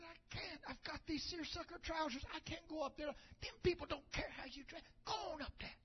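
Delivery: soft whispered aside; whispering to neighbour. Retold or imitated. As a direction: lean in and whisper the question. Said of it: "He said".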